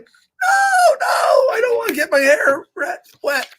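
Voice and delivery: very high-pitched whiny protest; wailing Piggy. Acted. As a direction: wail it like a pig dreading a soaking. Piggy dreads getting rained on.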